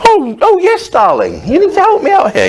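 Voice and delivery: very high, fussy put-on voice; a mincing falsetto "oh yes, darling"; mock prissy. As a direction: ham up a dainty cry after smashing a thumb. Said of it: "split my thumb".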